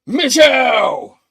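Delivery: long, high, full-volume holler; shouted name. Retold or imitated. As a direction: bellow the name from out of nowhere. Voice suddenly calls out from nowhere.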